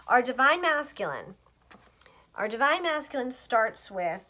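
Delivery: normal talking voice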